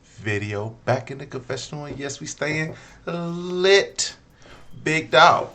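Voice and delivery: loud, high hyped-up intro; hype YouTuber voice. Acted. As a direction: pump up the crowd.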